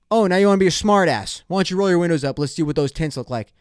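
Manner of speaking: loud, high confrontational cop, easing toward the end; irritated cop. Retold or imitated. Cop ordering smartass driver's windows up, irritated. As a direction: imitate an officer barking a challenge. Cop's retort, louder angrier take.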